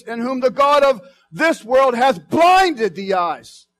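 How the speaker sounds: high, loud preacher's cry; preaching at full force. Acted. Preacher crying out to the church about blinded eyes, high and loud. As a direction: climb and shout it over the church.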